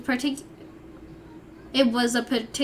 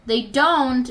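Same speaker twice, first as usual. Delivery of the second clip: ordinary talk, nothing marked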